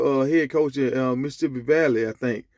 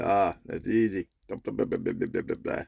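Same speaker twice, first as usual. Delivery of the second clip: low, soft, offhand gatekeeper voice; breezy dismissal; 8 semitones lower, 7 dB quieter than usual. Retold or imitated. Gatekeeper turning a man away from heaven, acted offhand dismissal. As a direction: rattle off the answer low and unimpressed.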